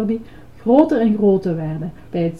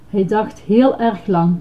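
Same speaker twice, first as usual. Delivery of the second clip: ordinary delivery, nothing marked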